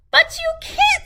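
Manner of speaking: very high, loud retort; gingerbread man. A narrator's reading. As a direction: shoot it back cheekily. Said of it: "said the gingerbread man".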